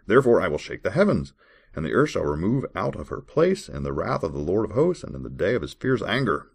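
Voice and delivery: measured, formal Bible reading voice; scripture reading. Acted. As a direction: read the verse like a solemn lesson. Reads Bible verses aloud.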